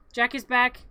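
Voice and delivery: ordinary talk, nothing marked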